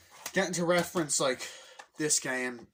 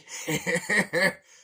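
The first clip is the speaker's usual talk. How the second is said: loud, high mocking laugh; put-on villain guffaw; Donkey Kong laugh; 6 semitones higher, 8 dB louder than usual. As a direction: laugh big at a puny opponent. Acting Donkey Kong laughing at Mario.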